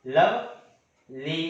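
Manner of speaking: Lovely slowly, लव, long pause, ली; syllable-by-syllable demo. Shown as a demonstration. Teacher demonstrates a two-syllable word, syllable by syllable. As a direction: break lovely into two separate beats. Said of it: "दो बार pronounce करने के बाद".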